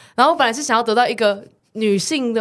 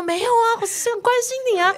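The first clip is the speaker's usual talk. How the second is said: much higher, sugary caring tone; sweet caring voice; 13 semitones higher than usual. Retold or imitated. Retelling to her friend, a high sweet caring voice. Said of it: "我想说".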